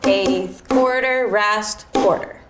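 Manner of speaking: note names spoken in time with the beat; measured, evenly spaced rhythmic call; counting out notes in rhythm. Shown as a demonstration. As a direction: call out each note as you strike it. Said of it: "saying the type of notes that I'm playing as I play".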